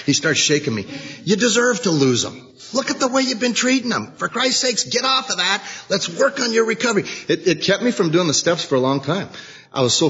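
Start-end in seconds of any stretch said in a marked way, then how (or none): 1.2-7.1 sponsor scolding. Retold or imitated. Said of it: "He goes".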